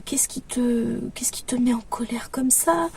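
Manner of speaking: very soft, true whisper; hushed, coaxing whisper of concern; whispering to a child. Acted. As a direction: whisper it gently to an upset little one. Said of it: "en chuchotant".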